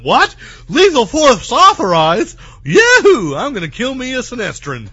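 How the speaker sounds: hammed-up yokel yelling with glee; fake cowboy accent. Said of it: "Leonard went".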